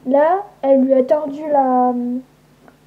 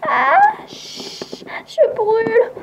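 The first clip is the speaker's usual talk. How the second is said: lent, aigu, gémissant; brûlure douloureuse; 10 semitones higher, much slower than usual. Acted. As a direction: geint de douleur au soleil. Cri de douleur au soleil. (plainte aiguë de brûlure)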